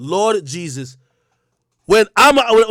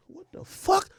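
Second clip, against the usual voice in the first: high squeak of shock, kept soft; hushed disbelief; 11 semitones higher, 10 dB quieter, slower than usual. Retold or imitated. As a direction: gasp it to yourself. Inner shock at the price.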